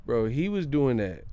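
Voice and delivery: usual speaking voice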